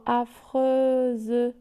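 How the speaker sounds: "affreuse" slowly split as a-freu-ze; syllable by syllable. Shown as a demonstration. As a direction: hold each syllable of "affreuse" apart. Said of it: "où se trouve la syllabe". (« affreuse » lentement découpé en syllabes)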